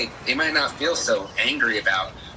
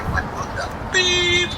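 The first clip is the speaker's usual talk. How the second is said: mock outraged stranger, high and slow; angry stranger; slower than usual. Stranger on the street reacts to racism talk, high mock outrage. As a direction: squeak it out like a baffled passerby. Street person voiced angrily.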